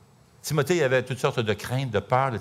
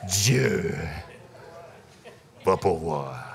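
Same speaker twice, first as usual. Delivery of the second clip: lower, slow and weighty proclamation; deep Jeff voice; 7 semitones lower, 4 dB quieter, much slower than usual. Retold or imitated. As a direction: lay down the verdict like a booming prophet. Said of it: "il a une grosse voix".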